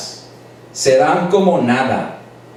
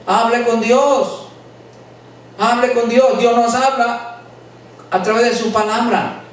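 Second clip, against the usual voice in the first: fervent preaching; 7 semitones higher than usual. Acted. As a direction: preach it out to the whole church. Preaching at full force to congregation.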